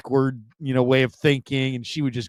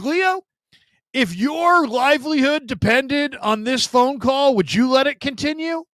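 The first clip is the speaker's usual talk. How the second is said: imitating Gary questioning Leo; 12 semitones higher, 3 dB louder than usual. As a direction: pose it like a deadpan host challenge. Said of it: "He'd be like".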